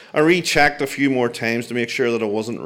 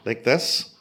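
awkward. Retold or imitated. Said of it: "I said awkwardly".

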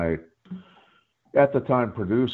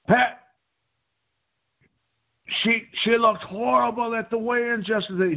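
high-pitched mock Australian commentator; Australian accent pushed well up in pitch; attempted Australian accent; 12 semitones higher than usual. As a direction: put on an amateur Australian broadcaster voice. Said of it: "in his Australian accent".

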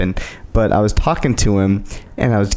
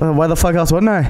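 imitated British accent, higher pitch; 5 semitones higher than usual. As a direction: answer back in a put-on British accent. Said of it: "you should hear him talk".

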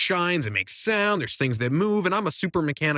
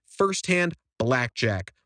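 ordinary talk

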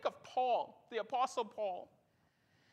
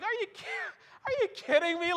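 mock indignant disbelief; 7 semitones higher, faster than usual. Retelling indignation at counter lady.

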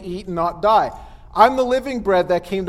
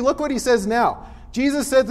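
ordinary talk

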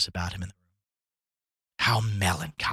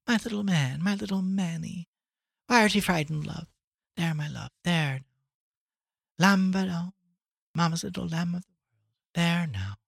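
higher, tender, soothing murmur; 9 semitones higher than usual. A narrator's reading. Mother soothing her frightened baby, tender murmuring.

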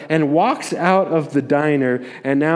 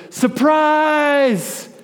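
excited crowd yell of surprise; surprise shout; 11 semitones higher, much slower than usual. Retold or imitated. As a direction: yell it like the whole room jumping out.

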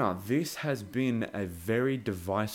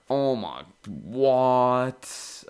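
stunned disbelief; 3 semitones higher, 7 dB louder, much slower than usual. Real, not acted. Reacting to shocking trailer line.

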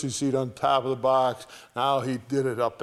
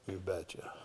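much softer and lower repeat; soft echo; 6 semitones lower, 14 dB quieter than usual. Acted. Preacher echoes God's answer under his breath. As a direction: let it fall to a murmur.

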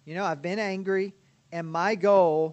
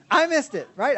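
laughing; 9 semitones higher, 8 dB louder than usual. Real, not acted. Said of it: "I'm the trained counselor".

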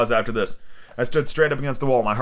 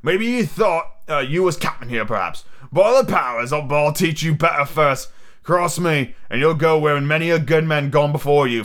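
higher-pitched threatening pirate captain; Long John Silver, menacing; 4 semitones higher than usual. Acted. Silver threatens Morgan for challenging him, menacing pirate role. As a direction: lay down the law as an old sea rogue.

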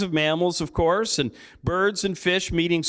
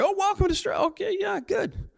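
much higher, over-eager greeting; forced cheerful welcome; 12 semitones higher than usual. Acted. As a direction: gush a welcome you don't quite feel. Acting greeting new family.

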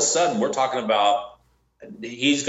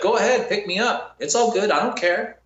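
higher, mock careless taunt; 10 semitones higher than usual. Bounty hunter mimics the suspect's careless taunt. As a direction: imitate his shrugging dare, higher. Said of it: "He was like".

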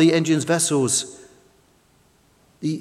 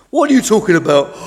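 mildly yawned question; yawning; 5 semitones higher, 8 dB louder, faster than usual. A narrator's reading. Tug has just woken up, lightly yawning. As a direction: ask it through a waking yawn. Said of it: "yawned Tug".

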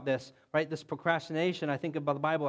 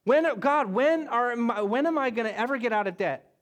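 a weary believer crying out to God, pleading; desperate prayer; 5 semitones higher, 4 dB louder than usual. Acted. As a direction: plead like someone stuck in a mess. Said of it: "somebody in a relationship or a kid".

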